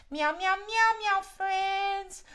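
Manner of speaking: high puppet cat. Acted. Max the cat greets the kids, high puppet voice. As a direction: squeak it out like a little cat.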